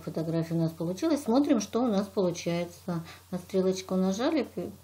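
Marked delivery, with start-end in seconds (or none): none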